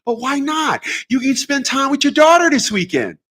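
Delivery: high, sweet-talking lawyer; high pitched mock-persuasive attorney; coaxing attorney voice. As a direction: coax him like a slick attorney. Attorney voiced pleading with him.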